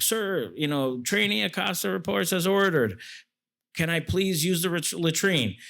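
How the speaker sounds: high, timid recruit voice asking permission; nervous recruit. Retold or imitated. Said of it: "And so you'd be like".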